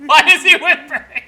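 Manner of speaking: words squeezed out through laughter; laughing. Real, not acted. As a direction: laugh while asking it, pitch way up. Laughing while asking.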